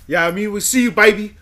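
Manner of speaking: loud, high and hyped; excited shout-out. Real, not acted. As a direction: call it out like a radio hype man. Hyping a guest on air.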